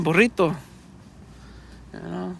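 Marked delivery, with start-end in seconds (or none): 0.0-0.6 "burrito" with a rolled Spanish R. Shown as a demonstration. Correcting someone who can't roll R's, "burrito" with rolled R. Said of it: "You gotta roll that R".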